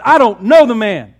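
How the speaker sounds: very loud, cursing denial; angry outburst. Acted. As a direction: spit out the denial in fury. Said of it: "He cusses at him".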